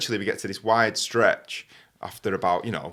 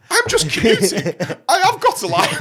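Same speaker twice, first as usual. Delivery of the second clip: loud, high, half-laughing protest; hammed-up outrage breaking into laughter; indignant protest; 12 semitones higher, 9 dB louder than usual. Imagined protest to driver, laughing.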